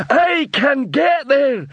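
high, slow, proud troll repeating. A narrator's reading. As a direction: echo the words like a slow troll. Troll repeats dwarf's words.

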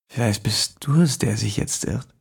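gedämpft drohende Fremdenstimme; leise Drohung. A narrator's reading. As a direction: ruhig und bedrohlich. Fremder droht. (leise drohender Fremder)

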